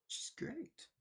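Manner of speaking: high, soft, wispy put-on voice; silly echo voice. Streamer echoing their own praise of a viewer, silly little voice. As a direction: repeat it as a tiny playful aside.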